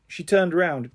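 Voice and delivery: ordinary delivery, nothing marked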